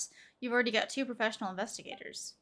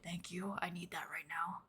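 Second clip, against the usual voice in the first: breathy whisper, softer and lower; relieved whisper; 4 semitones lower, 9 dB quieter than usual. Real, not acted. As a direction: murmur thanks under your breath. Grateful for a drink.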